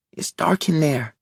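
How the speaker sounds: put-on a small boy peering into the dark; child's voice. A narrator's reading. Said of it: "he observes".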